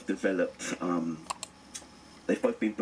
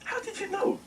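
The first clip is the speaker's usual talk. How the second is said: amazed question. Retold or imitated. Asking cousin years later.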